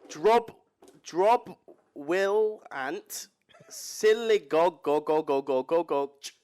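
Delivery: loud, halting English sound-out; English-accented, word-by-word mangling; English accent reading Welsh. Shown as a demonstration. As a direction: read the Welsh piece by piece in English. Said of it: "So it'd be".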